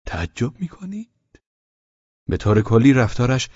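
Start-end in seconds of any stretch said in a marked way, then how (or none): 0.1-1.4 high, soft, slow question; Pavel Pavlovich. A narrator's reading. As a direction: ask slowly in a soft lifted voice. (پرسش آرام و کشیده با صدای زیر)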